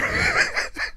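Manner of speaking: high, soft trailing giggle; helpless laugh. Real, not acted. Storyteller laughing at his terrified self, giggling. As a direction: dissolve into quiet laughter.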